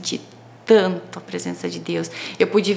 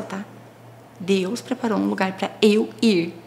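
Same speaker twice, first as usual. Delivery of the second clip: usual speaking voice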